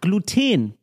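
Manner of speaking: put-on Gluten as glu-TEEN, second syllable long; slow, Frenchified Gluten stressed at the end; French-sounding. Shown as a demonstration. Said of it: "Klingt sofort französisch".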